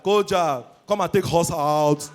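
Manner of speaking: drawn-out Yoruba H before vowels; Yoruba accent. Acted. Yoruba lady asking to be taken out, Yoruba accent. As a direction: add an H to "out" and "us" Yoruba-style. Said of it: "They're proud of their H factor".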